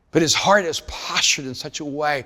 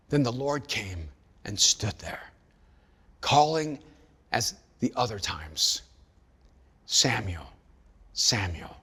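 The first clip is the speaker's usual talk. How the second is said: scripture reading. Acted. Reads scripture aloud.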